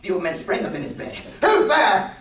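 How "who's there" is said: high, loud acted shout; sudden theatrical challenge into the dark; startled cry. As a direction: cry out as the alarmed old man. Startled old man calls out.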